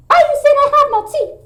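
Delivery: very high, fast little character voice; high cartoon kid striking back; squeaky pretend character.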